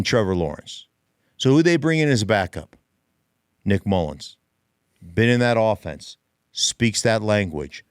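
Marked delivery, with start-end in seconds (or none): none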